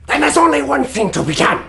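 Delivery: usual speaking voice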